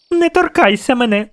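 put-on very high little girl's protest; screeching child. A narrator's reading. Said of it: "дерлася вона".

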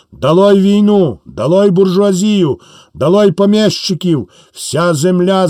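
rally the crowd, building up; rousing speech. Acted. Wounded soldier rousing villagers at the church, impassioned.

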